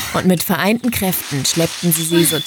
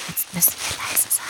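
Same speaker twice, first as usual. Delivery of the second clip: whispering; 13 dB quieter than usual. Acted. Sneaking up on landing site.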